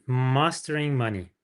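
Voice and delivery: usual speaking voice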